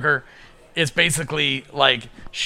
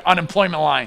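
normal talking voice

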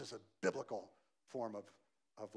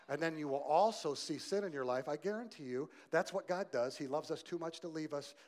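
normal talking voice

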